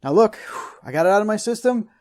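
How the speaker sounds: usual speaking voice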